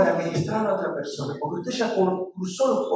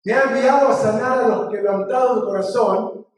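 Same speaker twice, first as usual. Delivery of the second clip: raised, loud preacher's declamation; scripture recitation; 4 semitones higher, 4 dB louder than usual. Acted. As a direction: proclaim it phrase by phrase for the congregation to repeat.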